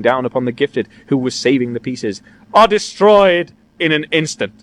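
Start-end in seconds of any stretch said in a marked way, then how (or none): 2.5-4.5 raised, slow theatrical despair; louder, drawn-out lament; anguished lament. A narrator's reading. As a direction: grieve it out heavily. Old man laments his ruined work.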